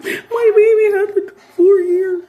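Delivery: very high, loud, slow hammed-up sob; mock wailing mom. Mother crying to the moms' group, hammed-up wailing sob. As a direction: wail it like a melodramatic mother.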